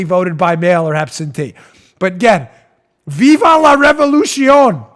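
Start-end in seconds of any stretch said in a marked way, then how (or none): 3.1-4.8 louder, higher, drawn-out slogan; mock rallying cry. Host mocking would-be revolutionaries, loud hammed-up rallying cry. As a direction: proclaim it like a revolutionary.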